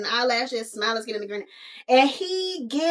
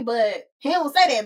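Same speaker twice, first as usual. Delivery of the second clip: ordinary delivery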